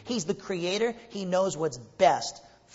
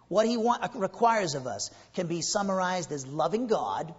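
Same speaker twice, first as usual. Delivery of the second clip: usual speaking voice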